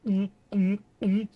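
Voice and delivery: high, loud hammed-up hums; exaggerated slurping hums. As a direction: overdo the drinking noise mockingly. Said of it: "he exaggerated it more".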